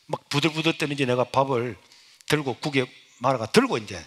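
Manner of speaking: ordinary talk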